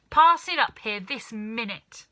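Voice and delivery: put-on loud, bossy old hag's order; shouted demand. Grandma demanding George's cup, shouting. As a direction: demand the cup at full volume. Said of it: "the enormous old hag shouted".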